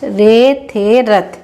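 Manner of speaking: very slow, letter by letter; drawn-out teacher blending; slow sounding out. Shown as a demonstration. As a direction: spell it out slowly for a child. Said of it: "देखिए, इसको ऐसे लिखेंगे".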